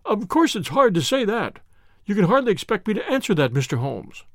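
ordinary delivery

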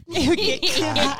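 laughing it out slowly; real laughter. Real, not acted. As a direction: giggle through the words. Laughing at joke.

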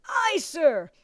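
high, strained shout back; recruit's reply. A narrator's reading. Recruit to bellowing drill master, strained shout.

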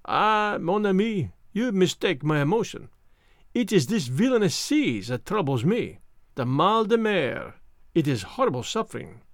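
put-on French-accented detective, plaintive with seasickness; Poirot, suffering. Poirot explains his seasickness to his friend, suffering, French-accented. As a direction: complain of misery in a French accent.

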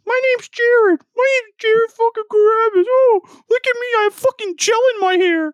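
very high, loud squeaky mockery; whiny mocking voice. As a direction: shriek it like a whiny brat. Mocking impression of Jared.

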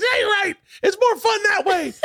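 imitated high-pitched excited yelling; old school Bobby. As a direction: agree eagerly in a hammed-up high voice. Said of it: "and he goes".

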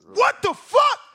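high, loud disbelieving yell; loud exasperated outburst. Real, not acted. Watching the stoppage, yelling in outrage. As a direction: blurt it out in outrage.

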